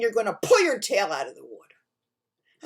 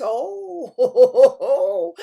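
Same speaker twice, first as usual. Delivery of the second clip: loud, high "oh" rolling into laughter; gleeful fox; 5 semitones higher, 13 dB louder than usual. A narrator's reading. Fox hears the bear agree, loud gleeful laughing. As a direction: crow it with a big chuckle. Fox delighted by bear's consent.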